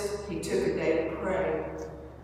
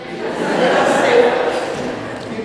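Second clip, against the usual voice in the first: embarrassed laugh; 5 semitones higher, 12 dB louder than usual. Real, not acted. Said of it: "why can't I say that word?".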